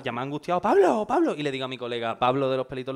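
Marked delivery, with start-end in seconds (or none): 0.6-1.3 high, loud calls of the name; anxious calling. Retold or imitated. Worried friend shouts Pablo's name at the locked door. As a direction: shout for him, getting worried.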